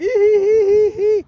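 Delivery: sudden high-pitched laugh bursting out; mocking cackle. Real, not acted. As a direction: laugh loud and high at the idea. Mocking Trader Joe's shoppers.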